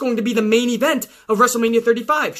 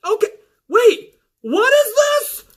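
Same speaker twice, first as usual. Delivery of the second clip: very high, shouted confusion; bewildered referee; 11 semitones higher than usual. Retold or imitated. Referee finally asks what it is, very high and bewildered. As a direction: squeal it, totally lost. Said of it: "Mike Chioda's like".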